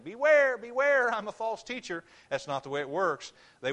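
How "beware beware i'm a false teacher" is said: high, loud mock "beware"; mock warning. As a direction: ham up a carnival warning call. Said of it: "neon sign saying".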